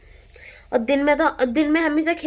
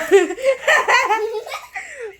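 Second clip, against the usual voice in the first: real laugh; 13 semitones higher, 4 dB louder than usual. Real, not acted. Laughing at dad's fun.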